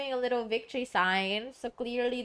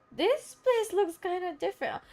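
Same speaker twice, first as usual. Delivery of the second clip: lightly squeaky game companion; high character voice; 9 semitones higher than usual. Acted. Game companion noticing a changed place, high character voice. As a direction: voice it high, a little restrained.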